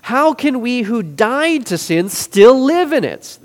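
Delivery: incredulous rhetorical question; rhetorical punch. Shown as a demonstration. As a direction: drive the question home slowly. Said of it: "rhetorical question that really drives the point home".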